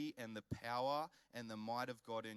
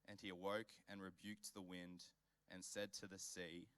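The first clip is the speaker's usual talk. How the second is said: measured, softer Bible reading voice; hushed scripture reading to the congregation; 3 semitones lower, 4 dB quieter than usual. Acted. Reads the Bible passage aloud.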